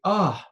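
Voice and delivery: loud, exasperated "ugh"; frustrated groan. Shown as a demonstration. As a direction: let out a venting groan. Venting alone in the car.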